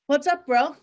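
cheerful call, all energy; upbeat greeting. Retold or imitated. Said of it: "upbeat, ready for my morning dose".

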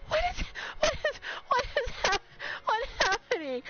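high, stammering squeal; high-pitched, stuttering bewilderment; overwhelmed disbelief. Real, not acted. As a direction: squeak it out in shock. Overwhelmed by gifted subs.

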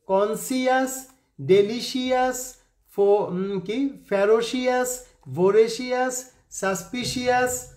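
কনসিয়াস, ডেলিশিয়াস said with a full si-as ending as spelt; spelling pronunciation. Shown as a demonstration. Teacher sounds out spellings as a memory trick. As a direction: read each word letter by letter, ending sounded as -সিয়াস. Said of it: "আমি pronunciation মনে রাখার জন্য বললাম".

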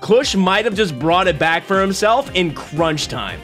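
usual speaking voice

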